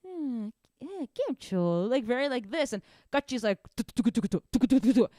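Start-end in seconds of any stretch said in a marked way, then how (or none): 0.0-1.9 drowsy, drawn-out imitation of a language; teasing half-asleep impression; mock sleepy drawl. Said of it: "sound like you're always falling asleep". 3.8-5.1 loud rapid-fire staccato syllables; teasing imitation of an emphatic language; mock angry dialect. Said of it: "it's like the pronunciation and the emphasis".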